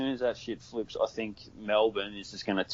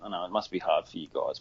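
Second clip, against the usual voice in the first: usual speaking voice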